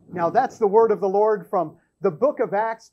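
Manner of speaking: ordinary delivery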